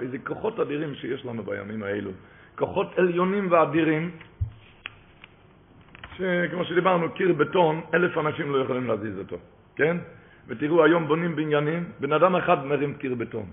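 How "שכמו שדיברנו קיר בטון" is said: usual speaking voice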